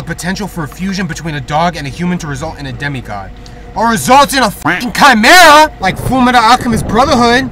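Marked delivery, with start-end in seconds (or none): 3.8-7.5 sudden loud exclaim; loud outburst. Real, not acted. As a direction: blurt it out loud. Outburst while reading game text.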